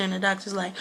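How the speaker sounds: normal talking voice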